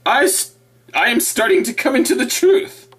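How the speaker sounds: high mocking husband voice. Mocking the converted husband, high voice. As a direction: mimic the husband, pitched well up. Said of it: "until their husband said".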